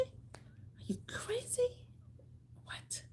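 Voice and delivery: whisper. Real, not acted. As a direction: whisper "Are you crazy?" as a playful aside. Repeats as a whisper.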